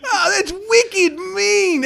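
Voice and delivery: mock Kiwi slang.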